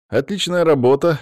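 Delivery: high, loud, delighted praise; joyful exclamation. A narrator's reading. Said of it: "радостно воскликнул Олег".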